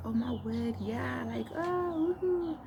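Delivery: high giddy excitement; excited squeal. Retold or imitated. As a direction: squeal with joy, rising high. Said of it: "I was like".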